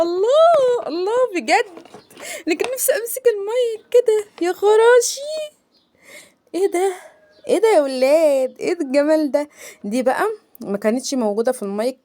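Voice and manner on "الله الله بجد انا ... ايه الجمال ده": much higher, louder cries of delight; awed, gushing unboxing delight; thrilled squeal. Real, not acted. Delighted by the mic.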